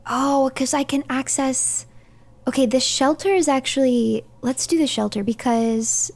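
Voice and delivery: ordinary delivery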